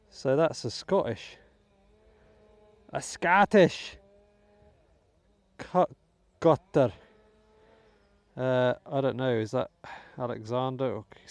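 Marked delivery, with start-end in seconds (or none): 2.9-4.0 higher, louder, drawn-out mock Scottish; terrible Scottish accent. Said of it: "forgive my terrible Scottish accent". 5.6-6.9 loud, hammed-up Scottish; terrible Scottish accent. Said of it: "forgive my terrible Scottish accent".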